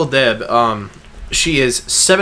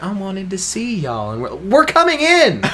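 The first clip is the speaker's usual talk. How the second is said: imitating grandma; 5 semitones higher than usual. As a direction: play sweet deaf great-grandmother. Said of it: "she's like".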